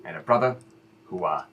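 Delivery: low, soft heartfelt advice; earnest fatherly talk. Acted. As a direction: speak low and sincere to your son. Father speaks earnestly about brother.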